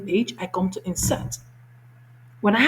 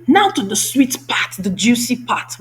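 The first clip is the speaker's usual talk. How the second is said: louder, slow and savoring; building excitement; 4 semitones higher, 6 dB louder than usual. Real, not acted. Teacher teasing the best feature, slow and excited. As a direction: relish the sweet part.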